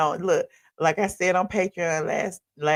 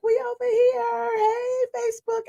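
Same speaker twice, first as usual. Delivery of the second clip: high squeaky imitation of eager fans; excited fan voice. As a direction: mimic fans bouncing and calling out. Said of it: "bouncing around like".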